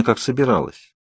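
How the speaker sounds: ordinary delivery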